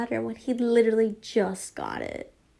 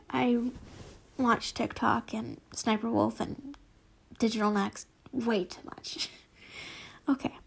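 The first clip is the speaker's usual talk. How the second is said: usual speaking voice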